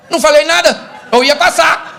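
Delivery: high, loud acted protest; hammed-up high-pitched indignant denial; indignant wife. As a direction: shout back defensively as the wife. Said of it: "Aí ela fala o quê?".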